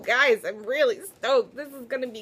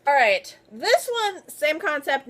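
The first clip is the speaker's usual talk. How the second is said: normal talking voice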